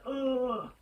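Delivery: long, drawn-out "ugh" of dread; a slow, weary ugh; disgusted groan. Shown as a demonstration. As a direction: groan it slowly, recoiling. Voicing her dread of going out.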